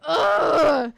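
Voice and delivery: loud, high, choked cry of rage; a strangled yell of fury; furious strangling grunt. Acted. Mid-strangling gesture at Alexander.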